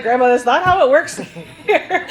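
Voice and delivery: laughing through the words. Real, not acted. Telling grandma the kitchen doesn't take orders, laughing. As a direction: laugh while correcting her gently.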